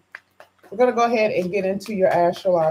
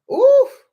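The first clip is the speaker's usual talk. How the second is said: delighted oof; 8 dB louder than usual. Real, not acted. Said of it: "Oh my God, I love it".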